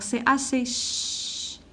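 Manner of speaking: slowly isolates the sound ch for the class; teacher slowly sounding out the letters for pupils, slow and deliberate; slow teaching voice. Shown as a demonstration. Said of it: "C H avec ouin".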